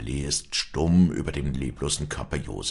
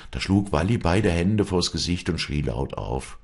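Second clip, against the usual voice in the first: ordinary delivery, nothing marked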